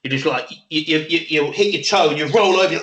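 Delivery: usual speaking voice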